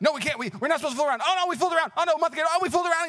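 high, frantic self-reproach; mock guilty panic. Teen regretting fooling around, high mock-panicked fretting.

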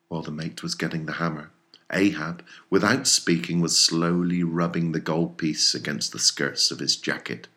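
ordinary delivery

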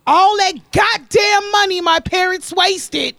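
loud, slow, venting shout; exasperated outburst. Real, not acted. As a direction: throw it out in disbelief. Griping about parents' wasted money.

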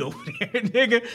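ordinary talk

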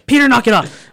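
high, loud exasperated order; scolding. Retold or imitated. Crewmate telling the captain to stop copying, sharp scolding.

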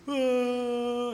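airy otherworldly ah of a dead man; mock ghostly moan; ghostly sigh. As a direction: breathe out like a drifting spirit. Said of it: "Tipo assim".

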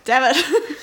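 usual speaking voice

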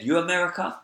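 broken-English query, imitated Chinese accent. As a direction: ask in a mock Chinese accent. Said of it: "I'm gonna impersonate their accent".

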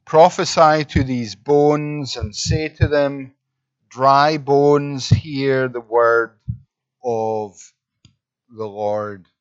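slow, weighty Bible reading; measured proclamation to the dry bones; solemn Scripture reading. Acted. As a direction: read it out slowly, pausing between phrases. Reads Scripture aloud.

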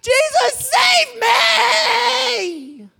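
desperate high-pitched scream for rescue; drowning man's panicked shriek; crying out. Acted. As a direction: wail it loud, stretching the last word. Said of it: "he's crying out to Jesus".

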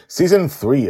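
usual speaking voice

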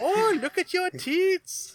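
drawn-out, high put-on Aussie voice, SNL-skit style; fake Australian accent. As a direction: ham up a silly Aussie skit character. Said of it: "I love fake Australian accents".

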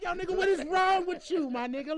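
acted-out exasperation, easing at the end; mock scolding. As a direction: tell off your dog in comic disbelief. Acted scolding of his dog.

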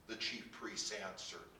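usual speaking voice

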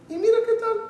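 squeaky high matchmaker urging him on; sing-song shrill matchmaker. Retold or imitated. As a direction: pitch it way up like a fussy matchmaker. Matchmaker voiced on phone.

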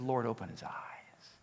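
soft hushed whisper; whispered prayer. Retold or imitated. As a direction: breathe the prayer barely aloud. Elisha praying quietly.

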